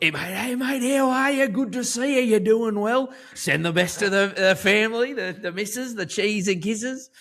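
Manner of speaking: high-pitched, rambling country Aussie greeting; Ned Hannigan. Acted. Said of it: "if you meet Ned, like".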